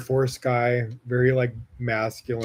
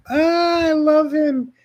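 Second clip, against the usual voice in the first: much higher, drawn-out squeal of adoration; squealing gush; 4 dB louder, slower than usual. Retold or imitated. Gushing about her boyfriend, a high excited squeal. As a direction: squeal it out like you can't contain it. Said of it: "makes me be like".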